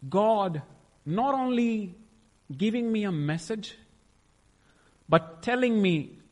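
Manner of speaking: overwhelmed. Real, not acted. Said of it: "It was overwhelming".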